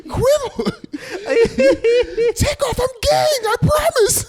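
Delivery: very high squealing plea; begging fan voice. Retold or imitated.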